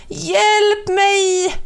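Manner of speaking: a towel shouting for help, high pitched; calling for help. A narrator's reading. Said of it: "ropade han till vinden".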